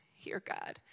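whispered, barely voiced; hushed prayer. Preacher retelling the king at the Lord's house, hushed prayer. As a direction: whisper it to God, very softly.

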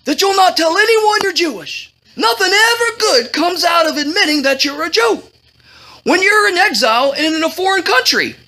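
high-pitched, insistent warning. A narrator's reading. Cousin makes her promise to hide her origins, fretful. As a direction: press the warning like an anxious relative.

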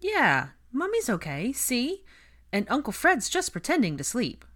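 high, bright sing-song comfort; reassuring a child. A narrator's reading. Ginny tells a boy his uncle is pretending, reassuringly. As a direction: keep it cheery to calm a little one.

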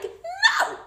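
high-pitched emphatic no; emphatic denial. Acted. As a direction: shoot up high on the refusal. Emphatic refusal to nag.

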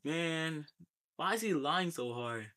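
usual speaking voice

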